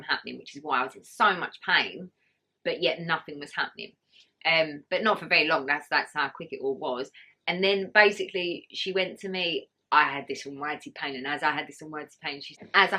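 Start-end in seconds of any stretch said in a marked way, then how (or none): none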